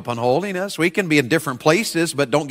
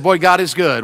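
ordinary talk

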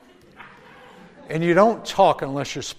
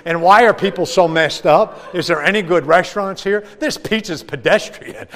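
put-on kid rambling on; 5 semitones higher, 9 dB louder than usual. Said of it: "Doug keeps going".

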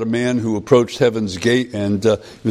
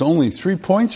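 higher, shocked question; gasped; 7 semitones higher than usual. Retold or imitated. Man shocked at his tiny score, gasping, higher. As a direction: gasp out the disbelief. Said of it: "he gasped".

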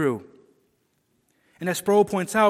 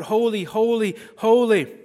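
raised, proclaiming voice; crying out; 4 semitones higher, 5 dB louder than usual. Acted. Veiled seraphs Isaiah heard, crying out in worship. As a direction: call it out higher, building in force. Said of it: "with veiled faces cry out".

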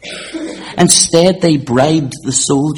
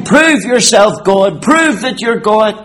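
raised, higher preacher's taunt; mocking taunt; 8 semitones higher, 6 dB louder than usual. Preacher voicing the mockers at the cross, mocking taunt. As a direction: throw it out as a challenge. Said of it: "And they mocked him".

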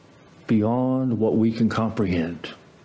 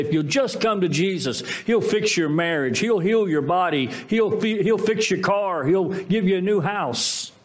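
mock sales pitch; 6 semitones higher than usual. Churches' promises mocked.

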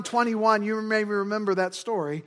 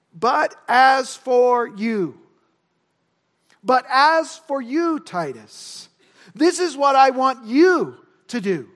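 slow, loud, deliberate stress on "you"; emphatic direct address; 5 dB louder, slower than usual. Acted. Paul regaining Titus's focus, emphatic and deliberate. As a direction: drive each word home, punching "you". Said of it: "emphatically addresses Titus here with the pronoun you".

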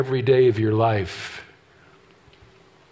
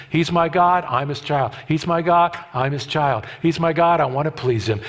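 put-on GOD up, child down, rocking back and forth; call and answer rhythm. Shown as a demonstration. Preacher teaching the church a chant, in a rocking rhythm. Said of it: "rhythmic repeated affirmation".

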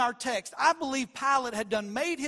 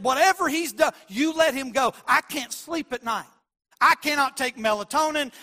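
louder, insistent pleading wife; Pilate's wife pleading; 4 semitones higher, 6 dB louder than usual. Retold or imitated. Wife begs Pilate to let Jesus go, louder insistent plea. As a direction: beg him, sleepless and desperate. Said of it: "She said".

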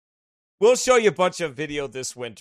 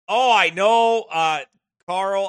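usual speaking voice

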